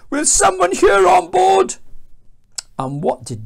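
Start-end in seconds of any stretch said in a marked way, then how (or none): 0.1-1.7 very high, loud shouted appeal; acted panicked captain; captain's alarmed cry. A narrator's reading. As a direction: call urgently to the whole ship. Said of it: "cried the captain".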